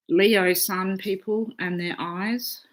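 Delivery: ordinary delivery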